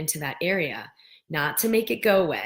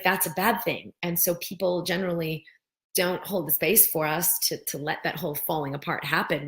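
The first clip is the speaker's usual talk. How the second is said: normal talking voice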